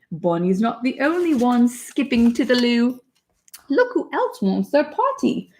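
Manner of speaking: put-on British accent. Mom reads a potty book to her daughter, British accent. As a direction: read it like a cheery British picture book. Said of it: "reading this book in a British accent".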